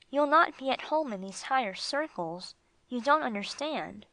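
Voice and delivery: plain reading voice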